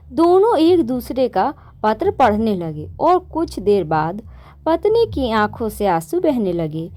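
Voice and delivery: ordinary delivery, nothing marked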